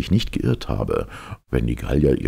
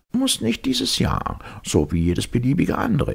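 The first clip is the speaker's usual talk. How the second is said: professor quizzing; 11 semitones higher than usual. A narrator's reading. Professor leading the captain through his system, high quizzing voice. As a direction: quiz him in a high professor voice.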